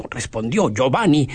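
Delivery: ordinary delivery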